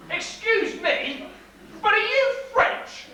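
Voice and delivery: over-enunciate as if to a foreigner; slow and careful. Acted. Porter asks the foreign stranger, slow and over-careful.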